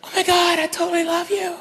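high-pitched gushing bride in a mock movie trailer; preview honeymooner. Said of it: "this is the whole preview right here".

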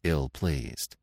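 usual speaking voice

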